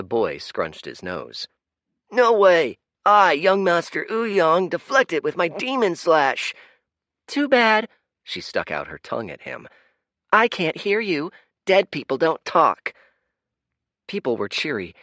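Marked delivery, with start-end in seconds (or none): 2.1-6.5 put-on high, loud bragging boy voice; a cocky kid showing off his move; boastful boy. Boy bragging to a girl during play, high and loud. 7.3-7.9 high, loud mocking girl's voice; teasing girl. Girl teasing her playmate, high and mocking. 10.3-12.9 high, loud mocking girl's voice; teasing girl. Girl teasing her playmate, high and mocking.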